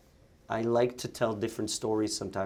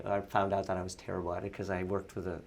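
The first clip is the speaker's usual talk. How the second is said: ordinary talk, nothing marked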